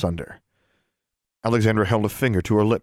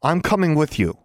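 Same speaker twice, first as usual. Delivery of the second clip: higher, determined statement; firm declaration; 6 semitones higher than usual. A narrator's reading. Roommate insisting on joining the rescue, declaring firmly. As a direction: declare it, allowing no refusal. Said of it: "Anna declared".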